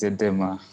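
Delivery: "Edema" with an open eh in the middle; correct name pronunciation. Shown as a demonstration. Participant telling host their name, "Edema" pronounced with open eh. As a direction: say "Edema" as eh-DEH-ma. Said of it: "how I pronounce your name".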